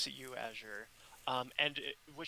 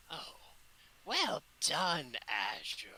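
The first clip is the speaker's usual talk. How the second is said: two voices as one; 3 semitones higher, slower than usual. Acted. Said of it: "two voices speaking as one".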